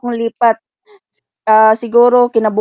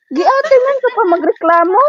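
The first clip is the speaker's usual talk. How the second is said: high, louder incredulous comeback; retold retort; 9 semitones higher, 4 dB louder than usual. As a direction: throw the complaint back at her. Retort to complaining employer.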